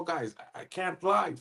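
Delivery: ordinary delivery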